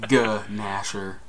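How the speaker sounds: Ganasher slowly split as "guh... nasher"; slow, separated guh-NASH-er in Ganasher; savored pronunciation. Shown as a demonstration. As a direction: draw out the G in Ganasher, then pause. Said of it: "the thought of the sound".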